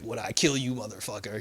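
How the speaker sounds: retold threat, dropping low and soft; lightly imitated cold hero threat; Batman. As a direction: let the curse sink quietly. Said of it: "And he's like".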